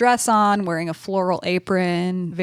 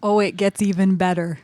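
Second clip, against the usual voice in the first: usual speaking voice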